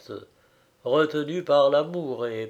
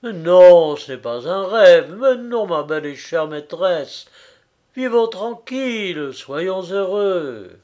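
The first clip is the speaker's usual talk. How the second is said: higher, emphatic lover's plea, starting loud; fervent reassurance; 4 semitones higher, 7 dB louder than usual. A narrator's reading. Frédéric reassures his dear mistress, fervent. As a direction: insist ardently, pitch lifted.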